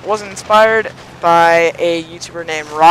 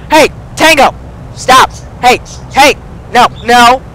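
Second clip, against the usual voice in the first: high, loud pet-owner commands; sharp scolding shout; 6 semitones higher, 6 dB louder than usual. Real, not acted. Owner calling Tango to stop, sharp scolding shout. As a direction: call out sharply to a misbehaving bird.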